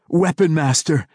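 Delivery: joyful cry. A narrator's reading. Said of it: "Drizzt cried".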